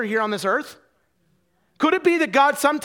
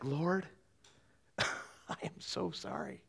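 slow breathy whisper through a laugh; whispered confession; 4 semitones lower, 16 dB quieter, much slower than usual. Retold or imitated. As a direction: whisper it to God, ashamed and half laughing. Confessing to God, ashamed again.

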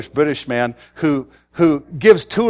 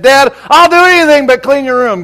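put-on higher and louder, a stubborn teen talking back; defiant teenage son; 11 semitones higher, 8 dB louder than usual. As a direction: play the cheeky son refusing his chore. Preacher voices defiant teenage son.

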